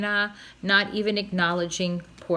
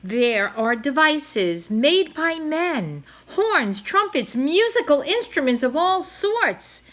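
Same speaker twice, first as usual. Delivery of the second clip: grand slow proclamation; 5 semitones higher, 4 dB louder than usual. A narrator's reading.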